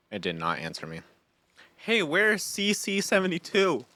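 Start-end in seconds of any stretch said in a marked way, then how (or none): 1.7-3.8 high, sing-out call. Pretend. Asking after a missing game companion, high questioning call. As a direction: call out in a raised pitch for someone missing.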